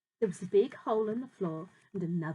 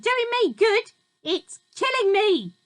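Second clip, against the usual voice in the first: high, loud, slowing wail; screeching old woman; 12 semitones higher, 11 dB louder than usual. A narrator's reading. Grandma screeching at George that the medicine kills her. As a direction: howl it like she's dying.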